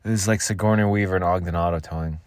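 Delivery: ordinary talk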